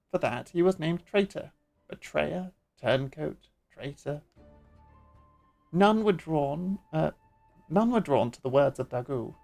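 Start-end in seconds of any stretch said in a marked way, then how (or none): 3.7-4.2 traitor. dropped to a hushed grim echo. Acted. 5.7-6.8 slow, weighty None were drawn; slow emphasis. Acted.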